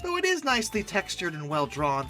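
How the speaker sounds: ordinary talk, nothing marked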